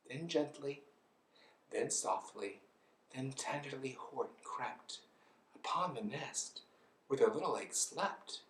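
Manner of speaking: soft, tender narration; gentle hush. A narrator's reading. Elephant creeps onto sleeping egg, soft tender narration.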